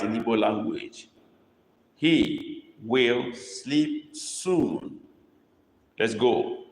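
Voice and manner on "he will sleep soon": He will sleep soon, slow, with will sleep lifted and stretched; stress on will sleep. Shown as a demonstration. Teacher dictating with the verb stressed, slow. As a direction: lean on will sleep and take it slowly. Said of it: "This emphasis here is will sleep".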